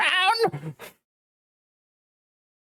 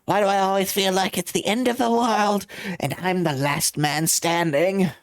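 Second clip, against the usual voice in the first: usual speaking voice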